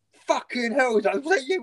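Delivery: high, loud mock accusation; imitating an appalled housemate; retold outraged exclamation. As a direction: burst out at the culprit, pitched well up. Retold accusation over the towel.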